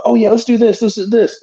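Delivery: casual high pep talk; breezy hype from a guy who bails; lazy wrestler. Retold or imitated. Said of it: "he would always just, like".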